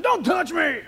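imitating a cranky little girl pushing her sister away; child snapping. Daughter shoves sister away, mock angry shout. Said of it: "Ashley moves over like this".

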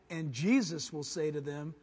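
ordinary talk, nothing marked

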